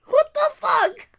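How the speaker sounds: normal talking voice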